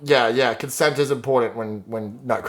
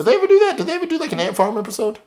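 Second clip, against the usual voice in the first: excited high question; 9 semitones higher, faster than usual. Real, not acted.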